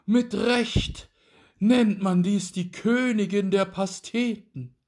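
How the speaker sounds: high, loud rapturous praise; delighted duke. A narrator's reading. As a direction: savour it aloud, then boast. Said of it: "schlug die Augen auf zur Decke und sprach".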